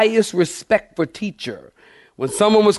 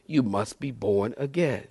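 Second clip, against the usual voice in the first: quiet; 4 semitones lower, 9 dB quieter than usual. Acted. Quiet retelling of Jesus' words.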